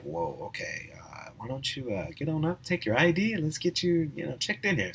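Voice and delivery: very soft, retold customer-service voice; hushed awkward politeness. Front-desk clerk to guest on the floor, hushed and awkward.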